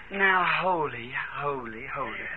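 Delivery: very low, hushed holy; just saying it lightly. Acted. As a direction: say it small and soft, deliberately underwhelming. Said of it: "They wasn't just saying". (very lightly, under the breath)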